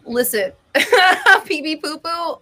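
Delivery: usual speaking voice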